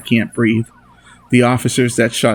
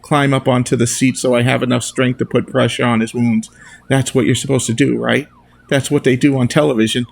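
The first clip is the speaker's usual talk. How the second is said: plain reading voice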